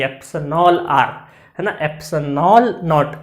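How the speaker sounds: slow, deliberate "एप्सिलॉन R", each syllable laid out; slow, careful "एप्सिलॉन नॉट"; careful practised pronunciation. Shown as a demonstration. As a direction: show off the practised word slowly. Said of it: "बहुत practice करी मैंने इसको बोलने की".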